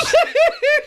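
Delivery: shrieking laughter; high-pitched laugh. Real, not acted. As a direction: burst into a squealing laugh. Laughing fit.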